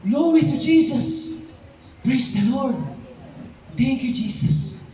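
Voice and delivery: drawn-out, exultant cries of praise; joyful praise. Acted. Pastor acting life breathed in, exultant praise. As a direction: shout praise to God, slow and glad.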